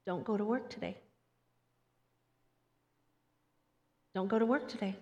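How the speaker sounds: soft, hushed divine prompting; quiet repeated warning; quiet inner voice. Retold or imitated. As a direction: hush it like a still small voice. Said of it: "the Holy Spirit telling them".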